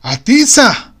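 loud, high startled cry; surprised exclamation. Acted. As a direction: blurt it out in surprise. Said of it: "exclamó el director".

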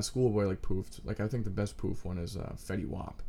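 ordinary delivery

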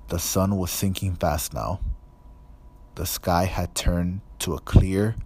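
ordinary delivery

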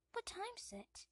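put-on high small boy's voice; a sleepy little boy asking; young Harry. A child asking his aunt the time, high boyish voice. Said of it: "says young Harry".

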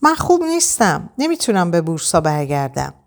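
ordinary delivery

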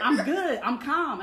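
loud, high insistence that betrays agitation; agitated, not calm. Retold or imitated. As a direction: insist on it far too loudly. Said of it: "No, you're not calm".

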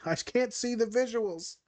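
high, slow, whiny mock student complaint. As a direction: parody a frustrated student who can't visualize. Mocking a struggling student.

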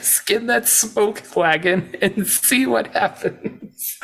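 high, slow, drawn-out imitation; stretched-out mock-serious quote; Wyatt. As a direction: savor each word of the dare, on the edge of laughter. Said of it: "It's delivered seriously".

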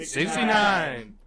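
mock-triumphant announcer yell; hyped shout. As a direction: belt it out like a hype man. Gleefully calls out episode number.

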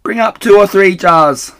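high, loud call from upstairs. Acted. Missus Bean calls down from upstairs, high and loud. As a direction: yell it down from far above. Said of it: "the other voice came back".

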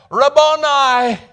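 high, loud, slow cry of recognition; cried out. A narrator's reading. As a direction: call out the name, overwhelmed. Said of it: "cried out in Aramaic".

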